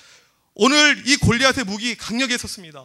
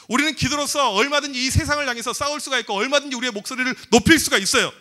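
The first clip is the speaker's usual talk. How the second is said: usual speaking voice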